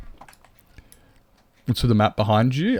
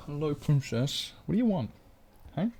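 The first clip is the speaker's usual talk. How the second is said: cooing pet voice; 6 semitones higher, 6 dB quieter than usual. Real, not acted. Cooing at her cat.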